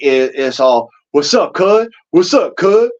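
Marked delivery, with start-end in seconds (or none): 1.1-2.9 put-on Grape Street LA accent. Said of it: "if you're on the other side".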